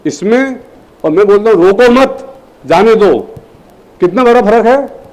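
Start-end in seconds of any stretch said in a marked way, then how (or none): 1.7-3.2 slow "रोको मत", brief break, "जाने दो"; pause after मत. Shown as a demonstration. Teacher contrasts the two readings, breaking after "मत". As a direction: hold "रोको मत" together, then release "जाने दो". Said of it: "केवल कॉमा लगाने से".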